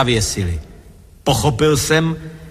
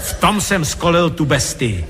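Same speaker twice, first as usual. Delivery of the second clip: ordinary delivery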